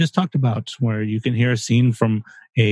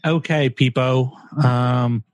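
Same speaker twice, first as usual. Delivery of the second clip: lightly put-on transatlantic-ish accent, slowed; a hint of a fake posh accent; mock accent. Signing off to listeners in a mildly fake accent. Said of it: "What is going on with that accent?".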